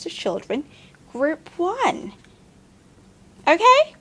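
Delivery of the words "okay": high-pitched puppet asking Okay; squeaky puppet voice. Acted. Puppet checks with teacher, very high and loud.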